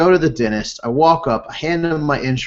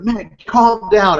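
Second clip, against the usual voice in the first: exasperated plea; 7 semitones higher, 4 dB louder, slower than usual. Retold or imitated. Wife reins in angry husband, retold.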